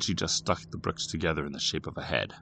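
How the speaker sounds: usual speaking voice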